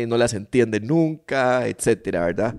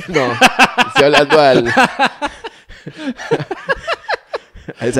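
high, loud squeal of laughter; laughing; 12 semitones higher, 7 dB louder than usual. Real, not acted. Host jokes he's talking to the shrub, laughing high. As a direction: crack up mid-sentence. Joking about the bush.

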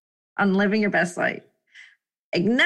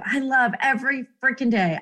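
ordinary delivery

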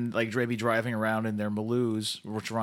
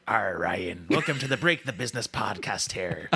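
low, jokey pirate welcome; playful pirate impression, pitched down; 4 semitones lower than usual. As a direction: growl the R's like a pirate. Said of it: "we're on pirates".

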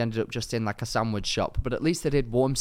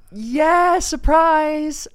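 much higher, louder mock cheerful surprise; hammed-up cheery reveal; fake surprise; 8 dB louder, much slower than usual. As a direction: oversell a surprise you didn't plan. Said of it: "And I go".

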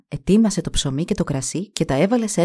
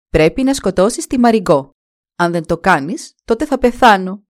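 loud, harsh threat to the king; demanding stepmother; 6 dB louder than usual. Acted. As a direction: force him with a loud ultimatum. Stepmother demands king kill daughter.

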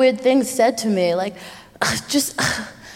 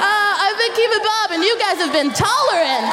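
excited sign-off; 9 semitones higher than usual. Real, not acted. Closing sign-off to audience.

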